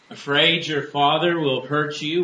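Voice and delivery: sarcastic. A narrator's reading. Teenage girl needling her friend about her father, sarcastic. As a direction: narrate a teenage girl's sarcastic jab. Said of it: "one of the girls asked sarcastically".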